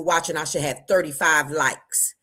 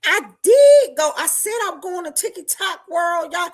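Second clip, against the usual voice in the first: high and loud, bursting out; excited outburst; 10 semitones higher, 7 dB louder than usual. Real, not acted. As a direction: blurt it out with excitement. Excited about TikTok World.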